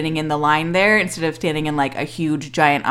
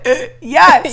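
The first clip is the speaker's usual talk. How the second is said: starstruck; 7 semitones higher, 6 dB louder than usual. Retold or imitated. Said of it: "And both of us went".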